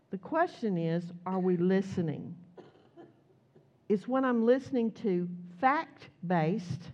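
usual speaking voice

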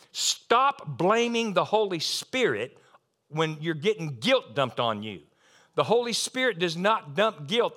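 normal talking voice

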